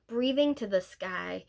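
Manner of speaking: usual speaking voice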